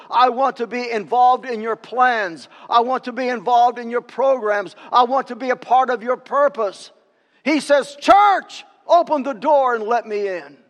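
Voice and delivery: impassioned pulpit appeal, building to a shout; crying out. Acted. Christ appealing to scores of churches, crying out. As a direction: call out urgently, peaking on "Church". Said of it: "Christ who cries out".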